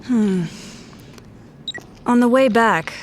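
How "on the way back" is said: noir narration. Acted. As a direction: tell it like a grim crime story. Detective narrates the case.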